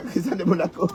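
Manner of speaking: laughing. Real, not acted. Laughs while saying the name.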